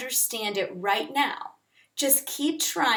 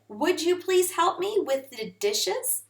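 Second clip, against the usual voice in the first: higher, rising polite request; 7 semitones higher than usual. Shown as a demonstration. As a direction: ask it politely, with a lift. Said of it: "You can also use would".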